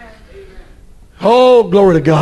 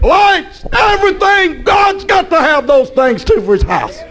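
high shouted preaching; fiery preaching; 8 semitones higher than usual. Real, not acted. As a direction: drive it home to the church. Preaching at full force.

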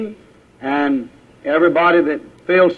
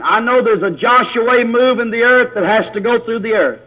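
higher-pitched, insistent preacher's declaration; fervent preaching; 5 semitones higher than usual. Real, not acted. Preacher testifying to the congregation about the Joshua move, fervent. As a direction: testify with conviction, pitch held high.

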